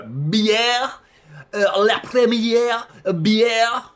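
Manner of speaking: loud, high, hammed-up French accent; mock French accent. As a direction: butcher it in a drawn-out fake French accent. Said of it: "just butchered your accent".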